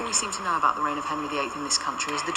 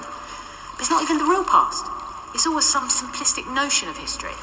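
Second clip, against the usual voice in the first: exasperated rant; 8 semitones higher than usual. Acted. Complaining to Parker about fake history, exasperated. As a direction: vent your frustration at the idea.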